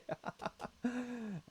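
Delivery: a breathy high giggle of relief. Real, not acted. Streamer giggling as his unit survives, high giggle. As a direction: let a giggle slip out. Laugh after he lived.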